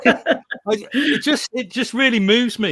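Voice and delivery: choked up but laughing through it; emotional. Real, not acted. Guest moved by rewilding talk, emotional laugh. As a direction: voice lifting with real emotion, half laughing. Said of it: "Sorry, I'm getting a little bit emotional here".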